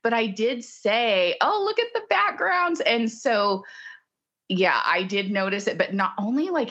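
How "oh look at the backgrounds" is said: high, bright surprised exclaim; delighted. Retold or imitated. Delighted noticing.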